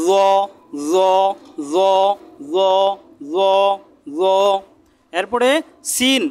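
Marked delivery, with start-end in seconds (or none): 0.1-4.2 "জ" said thick and heavy; som grosso. Shown as a demonstration. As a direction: repeat "জ" with a heavy, rounded z. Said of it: "মোটা করে একটু".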